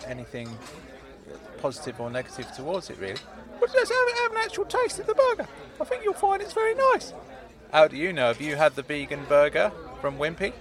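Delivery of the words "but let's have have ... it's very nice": high squeaky urging; high-pitched cheery character voice; coaxing Claude. Acted. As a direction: coax him in a high piping voice.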